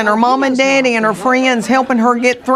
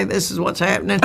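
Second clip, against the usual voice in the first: lightly voiced coaching sister; 7 dB quieter, faster than usual. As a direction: explain it softly under your breath. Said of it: "she would say".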